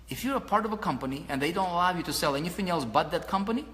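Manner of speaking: normal talking voice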